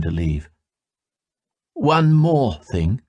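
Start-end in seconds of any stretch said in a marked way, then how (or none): 1.7-3.0 slow, weighted warning; menacing parting. A narrator's reading. Yanti turning back to warn Salmataya, slow menace. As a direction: linger on the threat.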